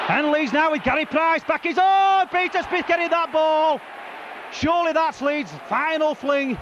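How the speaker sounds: excited rugby commentary, rising; live play-by-play. Real, not acted. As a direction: call the match live.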